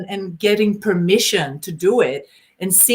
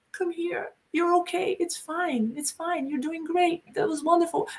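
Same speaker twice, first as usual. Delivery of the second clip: soft, higher, tender reassurance; soothing dominant; 8 semitones higher, 9 dB quieter than usual. Retold or imitated. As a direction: hush and comfort a shaken partner. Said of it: "he's like".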